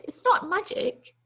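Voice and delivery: put-on a brisk granny inviting the boy in; kindly old lady. Old lady to the runaway boy, kindly coaxing.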